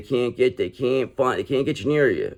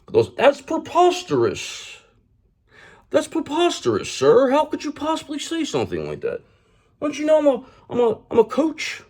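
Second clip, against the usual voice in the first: much higher, put-on squeaky voice of a pompous phony; mock indignant fraud. Tarot reader mocking the Emperor in Reverse, high squeaky indignant voice. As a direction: ham up an offended fake acting innocent. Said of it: "That's how they talk".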